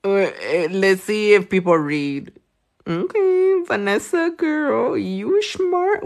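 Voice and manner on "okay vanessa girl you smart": much higher, delighted praise; excited praise. Real, not acted. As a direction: cheer the viewer on. Praising a viewer.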